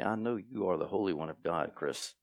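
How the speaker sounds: a hint of a demon muttering. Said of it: "hear a demon say".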